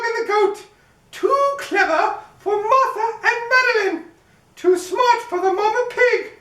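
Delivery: high bragging cookie, giggles in it; gingerbread baby voice, laughing. Acted. Cookie boasting it outran everyone, high gleeful chant. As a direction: crow about beating everyone, high and gleeful.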